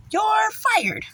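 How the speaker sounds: high, drawn-out dismissal; mock firing. Vlogger pretending to fire an official, high mock proclamation. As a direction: stretch it out high like a theatrical boss's sentence.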